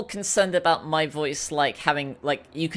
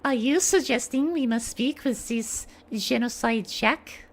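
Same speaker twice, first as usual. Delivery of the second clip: Celeste; 3 semitones higher than usual. Acted. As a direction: question in a put-on French-accented voice. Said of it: "But we'll try anyway".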